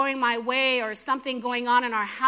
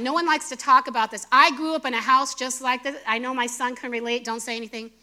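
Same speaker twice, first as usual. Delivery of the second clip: ordinary talk, nothing marked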